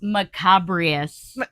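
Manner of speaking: "Macabrious" said very slowly, every syllable drawn out; slow announcing. Shown as a demonstration. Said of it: "word of the day".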